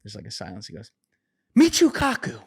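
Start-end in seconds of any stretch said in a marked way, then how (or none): 1.6-2.4 high, strained impression of an intense rant; mock ranting Weinstein. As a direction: ham up a self-serious genius demanding Michio Kaku. Imitating Eric Weinstein ranting on Rogan.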